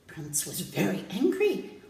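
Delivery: plain reading voice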